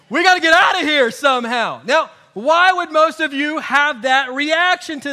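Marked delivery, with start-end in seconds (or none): 0.1-1.8 loud, high hammed-up panic; mock panic. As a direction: shout it like a caught-out sinner in a comic fright. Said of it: "My thought would be".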